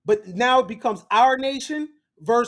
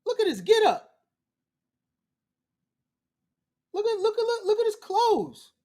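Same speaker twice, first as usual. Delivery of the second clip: amazed gawking; 9 semitones higher than usual. Acted. Marveling at his outfit.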